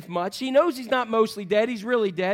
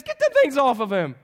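high, loud mock command; raised-voice, hammed-up order; acted order; 6 semitones higher, 6 dB louder than usual. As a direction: call out instructions to bystanders. Jesus ordering, comically acted.

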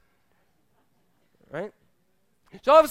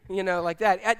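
usual speaking voice

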